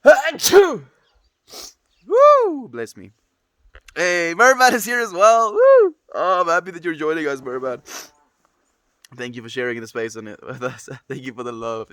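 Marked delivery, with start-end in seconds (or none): 0.1-0.8 big loud sneeze. Real, not acted. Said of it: "Here comes a sneeze". 2.1-2.7 excited woo. Real, not acted. Reacting after a sneeze. 3.9-7.8 excited greeting. Real, not acted. Greeting a newly arrived viewer.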